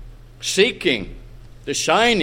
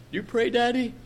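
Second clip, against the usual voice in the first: soft, higher, lightly voiced child; innocent little girl; 6 semitones higher, 6 dB quieter, faster than usual. Little girl asking her father, soft and innocent. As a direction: ask gently, imitating a little girl. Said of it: "A little girl innocently asked her father".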